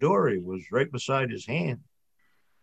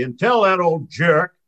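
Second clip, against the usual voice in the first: yelled taunt; 6 semitones higher, 7 dB louder than usual. A narrator's reading. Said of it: "yells Charlie".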